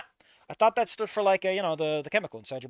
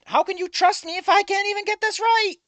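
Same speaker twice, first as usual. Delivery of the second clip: much higher, loud pleading complaint; exasperated whine; 6 dB louder than usual. Real, not acted. Streamer asking chat how they can trust him, high exasperated whine.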